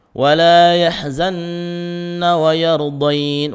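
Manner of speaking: slow melodic tajwid chant; Quran recitation. Shown as a demonstration. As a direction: chant the verse slowly with heavy lam.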